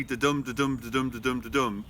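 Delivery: da-dum recited as a regular pulse, DUM stressed; iambic beat. Shown as a demonstration. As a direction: beat out da-dum weak-strong, sinking in pitch. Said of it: "although the poem is".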